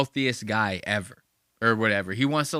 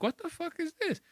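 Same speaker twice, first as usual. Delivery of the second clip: high, softer baffled reaction; mock bewilderment; 7 dB quieter than usual. Host reacts to a random text, mock-bewildered. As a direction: drop the volume and pitch it up, baffled. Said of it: "I'd be like".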